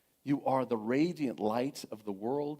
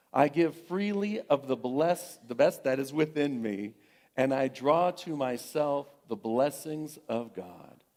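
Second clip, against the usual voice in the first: affirmation reading; 4 dB louder, slower than usual. Acted. Congregation affirms together.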